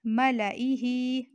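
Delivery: मलाइही said slowly, short alif, each syllable clear; correct Quranic reading. Shown as a demonstration. Teacher showing students the correct reading, slow and clear. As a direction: spell out मलाइही gently, no long a after lam. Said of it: "तो इसे हम पढ़ेंगे".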